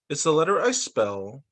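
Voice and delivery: ordinary delivery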